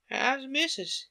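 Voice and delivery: friendly Yorkshire question; Yorkshire accent. Acted. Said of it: "pronouncing his words in a strange, broad fashion".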